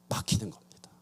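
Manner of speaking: hushed whispered ending; whispered climax of the sermon. Real, not acted. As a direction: drop to a breath for weight. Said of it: "sermon climax dropped to whisper".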